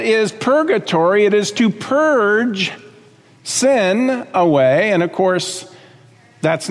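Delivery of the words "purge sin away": slow and deliberate. Acted. Defining purgatory slowly.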